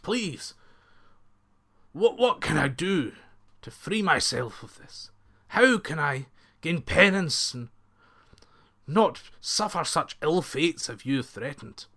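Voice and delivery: mild frightened plea, rising; a touch of begging from a scared man; pleading fearfully. A narrator's reading. Kneeling bandit chief begging the minister, mild fear. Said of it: "he was shaking".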